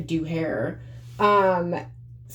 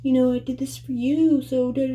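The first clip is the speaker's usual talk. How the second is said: mock guilt-trip; 7 semitones higher than usual. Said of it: "They'll be like".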